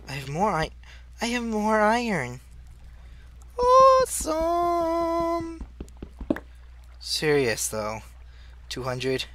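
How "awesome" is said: very high, slow, drawn-out "Awesome!"; high, slow, thrilled cry; delighted cry. Real, not acted. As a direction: stretch it out in delight.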